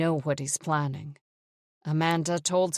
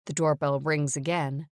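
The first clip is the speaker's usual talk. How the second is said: usual speaking voice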